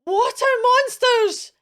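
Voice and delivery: very high, loud panicked cry; scared shriek; frightened cry. Acted. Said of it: "and one cried".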